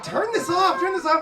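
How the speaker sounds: loud, high, urgent mock plea; wife's loud, high "turn this off"; panicked wife. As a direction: beg him to kill the song. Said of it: "my wife is like".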